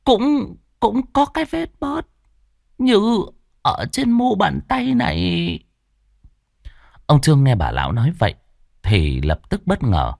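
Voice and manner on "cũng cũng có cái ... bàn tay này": put-on stammering old woman. A narrator's reading. Old woman spotting Trương's birthmark, stammering high and halting. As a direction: stumble over the words in shock. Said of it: "lắp bắp".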